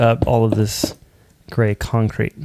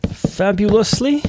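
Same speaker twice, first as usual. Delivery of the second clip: fabulously sung high and slow, rising; playful sing-song; 10 semitones higher, slower than usual. Real, not acted. Savouring the word for viewers, high sing-song.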